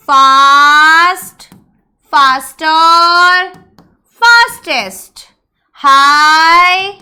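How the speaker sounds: each word drawn out slowly for students to write; slow dictation. Acted. Dictating word forms to class. (धीरे-धीरे लिखवाना)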